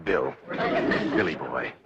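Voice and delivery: low, slow and chummy; coaxing. Acted. Banker sweet-talks suspicious Mister Hacker, coaxing. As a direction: sweet-talk him, drawn out low.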